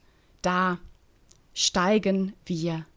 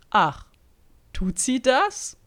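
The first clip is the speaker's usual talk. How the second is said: smug; 6 semitones higher, 4 dB louder, slower than usual. Acted. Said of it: "kommentierte Bettina suffisant".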